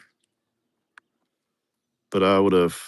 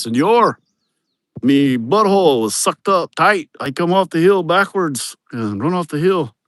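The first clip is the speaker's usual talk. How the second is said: higher, slower imitated Mexican accent; hammed-up Mexican accent retelling a scary ride; 7 semitones higher than usual. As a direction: mimic the worker's Mexican accent, higher pitched. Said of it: "one of those Mexican boys".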